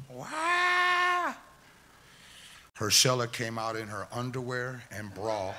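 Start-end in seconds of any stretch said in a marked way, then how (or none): 0.1-1.4 sudden high piercing wah, much louder; little sister's wail. Retold or imitated. Sister dumped from the shopping cart, piercing high wail. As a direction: shriek it like a startled little girl in a tipped cart. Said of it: "And Cheryl's voice".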